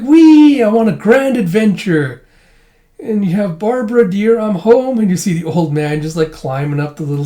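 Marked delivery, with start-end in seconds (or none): 0.0-2.2 put-on squealing high little girl, slowed; child voice. To the old man's arrival, a squeaky playful kid. Narrating cartoon, Anya voiced. 3.6-5.0 put-on high, loud doddering old man calling out. Old man coming home calls to his wife, high and doddering.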